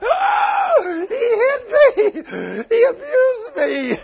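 very high, loud fake wailing; hammed-up falsetto wife crying he hit me; mock wife wailing. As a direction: squeal like a wronged wife. Mimics wife crying abuse.

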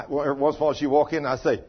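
ordinary talk, nothing marked

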